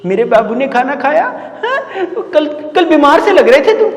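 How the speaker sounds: high-pitched imitation of a doting sweetheart; mock lovey-dovey. Mocks lovers' late-night chat. (प्रेमी की नकल में ऊँची, लाड़ भरी आवाज़)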